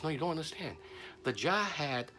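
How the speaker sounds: urgent insisting. Acted. Robbed man making his friend understand the lost wealth, urgent insistence.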